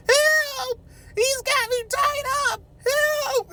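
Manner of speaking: high, loud squeal for help; mock scream for help. Trapped girl yelling up to the party girls, mock scream. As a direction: shriek it from the basement.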